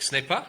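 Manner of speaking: "Ce n'est pas" lifting at the end like a question; questioning upturn. Shown as a demonstration. Coach showing how Latin speakers sound a question, rising at the end. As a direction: let "pas" rise into a question. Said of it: "So they go up".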